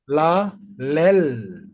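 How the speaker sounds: la, lel spelled out slowly; teacher sounding la, lel; reading demo. Shown as a demonstration. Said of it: "can you repeat the reading again".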